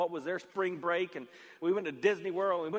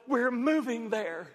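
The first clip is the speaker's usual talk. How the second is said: slow, high, louder reveal; excited announcement; 6 semitones higher, 5 dB louder, slower than usual. Retold or imitated. Father telling his son the big family news, excited. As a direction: break big news with drawn-out enthusiasm.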